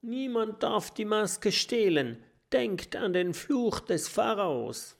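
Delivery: put-on high, pleading old-scholar warning; anxious professor. A narrator's reading. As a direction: warn them nervously in a high voice. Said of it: "stottert der Professor".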